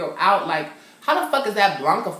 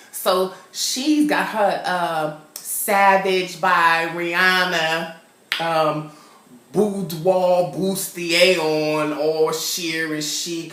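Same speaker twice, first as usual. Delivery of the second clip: drawn-out, relishing each word; slow savoring; 4 dB louder, much slower than usual. Real, not acted. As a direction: linger on the outfit like a catwalk. Savoring the lingerie description.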